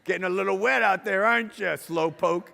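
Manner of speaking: hammed-up smug jerk laughing at her; mocking laugh. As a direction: gloat at her from the dry car. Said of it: "Like it was all a big plot".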